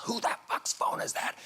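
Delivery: high, strained mock yell; imitated yell. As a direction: yell it like an irate assistant director, held back in volume. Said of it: "the first A D yells".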